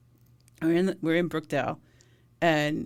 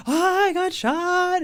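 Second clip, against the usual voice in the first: high, slow whining moan; whiny mock complaint; 14 semitones higher, 6 dB louder, much slower than usual.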